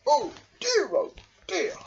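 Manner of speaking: high, repeated worried sighs; high anxious oh dear; fretting Badger. A narrator's reading. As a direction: fret in the storm. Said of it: "Badger frowned".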